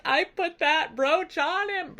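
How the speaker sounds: high-pitched, hammed-up tearful wail; badly faked weeping while talking; mock crying. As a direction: whimper it high, as a theatrical sobbing wife. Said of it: "Penelope starts crying".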